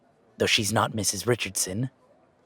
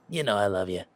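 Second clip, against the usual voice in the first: usual speaking voice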